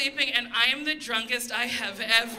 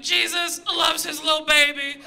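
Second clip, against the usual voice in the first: put-on a bit louder, higher, blessed drunk murmur; blissful drunk; 4 semitones higher, 4 dB louder than usual. Drunk woman at Shake Shack talking to nobody, blissed-out voice. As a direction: coo it like you feel blessed. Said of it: "I say to no one".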